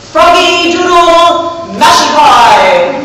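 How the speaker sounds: slow, loud proclamation; announcing loudly. Retold or imitated. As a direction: proclaim it grandly to the crowd. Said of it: "repeat it out loud".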